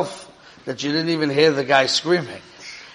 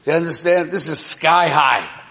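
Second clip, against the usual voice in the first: usual speaking voice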